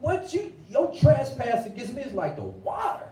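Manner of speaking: God restated in slang, lightly voiced; God in street voice. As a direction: talk it like a homie. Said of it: "he said".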